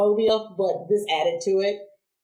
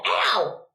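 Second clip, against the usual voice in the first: put-on quick high yelp, a kid burned by the comb; mock yelp of pain; 9 semitones higher than usual. A child yelping as mom's hot comb burns her ear. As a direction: cry out like the comb just touched your ear.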